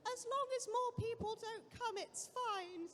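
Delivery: high, thin old lady impression; squeaky little old woman. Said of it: "Such an unflattering impression of the poor little old lady".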